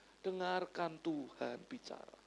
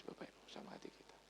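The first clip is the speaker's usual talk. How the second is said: whisper; 18 dB quieter, faster than usual. Real, not acted. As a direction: drop to a hushed whisper. Pastor confides to congregation. (berbisik pelan)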